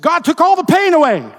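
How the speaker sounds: very high, very loud cry of relief; triumphant shout. Retold or imitated. Preacher voices a man freed from pain, triumphant shout. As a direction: shout it to the rafters. Climax of retold testimony.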